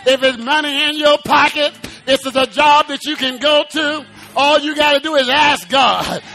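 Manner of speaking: normal talking voice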